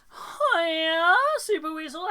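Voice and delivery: loud, high excited hello; excited greeting. Real, not acted. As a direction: squeal the greeting. Greeting a cat by name.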